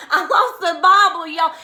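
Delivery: loud, laughing blurt. Real, not acted. Woman laughing to her cousin after losing a hair bobble. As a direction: laugh through the words.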